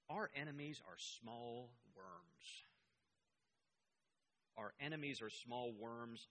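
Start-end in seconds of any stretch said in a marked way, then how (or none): 0.1-2.6 slow quote. Retold or imitated. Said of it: "Hitler told his generals".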